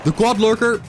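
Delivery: high, loud alarmed cry at the monsters; excited yell. Real, not acted. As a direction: shout it out, startled and thrilled. Sudden dangerous monster pack appears.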